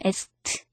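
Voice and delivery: "asked" ending in a released t sound; "asked" with no k, just s and t; final t in asked. Shown as a demonstration. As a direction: say "asked" like "ast", the t let out. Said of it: "이렇게 트라고 읽는다는 것도".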